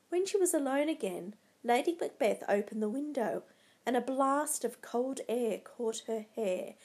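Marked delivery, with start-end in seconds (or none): none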